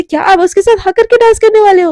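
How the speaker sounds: loud, high outraged question; shouting. Acted. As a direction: yell it at him in disbelief. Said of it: "इतना क्यों चिल्ला रही है बहन".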